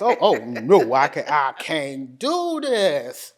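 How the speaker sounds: retold big, emphatic balking; loud comic refusal. Patient retelling her first look at dialysis centers, loud refusal. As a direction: protest loudly, stretching it out for laughs.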